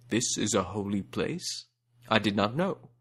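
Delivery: stunned elephant king; shocked. A narrator's reading. Hathi shocked to learn the place is holy. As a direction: let the shock show. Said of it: "Hathi was shocked".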